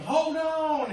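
loud, high-pitched driver's holler; shouted warning. Retold or imitated. Prankster driver warning soldiers in the back, loud high shout. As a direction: holler it to the passengers behind you. Driver warns passengers before speeding off.

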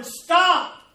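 loud, high pleading holler. Acted. Clay hollering at a potter who can't hear, pained holler. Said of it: "You're hollering".

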